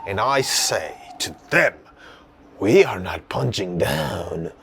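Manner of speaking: low, deliberate spoken-word reply; poem recital. Acted. Poet answering the venue, solemn spoken-word declaration. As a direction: pause between phrases for solemn weight. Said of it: "I just wanna tell you guys a poem".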